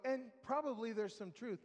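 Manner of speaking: usual speaking voice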